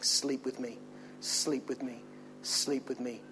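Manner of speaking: seductive quiet. Retold or imitated. Potiphar's wife nagging Joseph.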